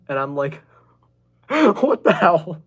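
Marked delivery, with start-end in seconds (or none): 1.1-2.7 incredulous laugh. Retold or imitated. Hearing favorite animal is dragon.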